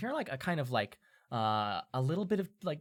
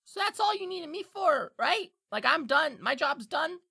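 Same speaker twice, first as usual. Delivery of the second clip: high, insistent imp voice; pushy little creature, pitched way up; Diplin wrapping up; 5 dB louder than usual. Acted. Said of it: "pats his jacket and he goes".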